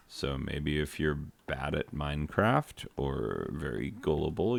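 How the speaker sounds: ordinary talk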